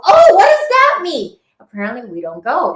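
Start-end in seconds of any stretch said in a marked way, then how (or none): 0.0-1.4 alarmed question. Retold or imitated. Retold alarmed question to a date.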